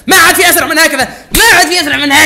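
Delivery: fast, loud excited repeat; excited shout. Real, not acted. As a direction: yell it like you just beat the clock.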